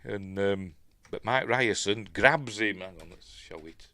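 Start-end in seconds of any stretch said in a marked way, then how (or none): none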